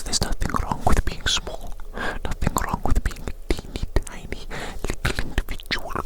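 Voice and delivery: normal talking voice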